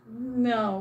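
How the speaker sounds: usual speaking voice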